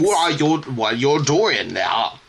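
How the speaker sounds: imitando un acento de Maine torpe y arrastrado; lento, como si las palabras no cupieran en las mejillas; mock Maine accent. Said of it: "He's like".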